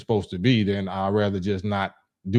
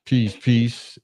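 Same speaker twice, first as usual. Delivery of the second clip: usual speaking voice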